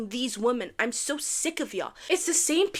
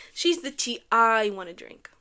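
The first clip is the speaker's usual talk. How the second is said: normal talking voice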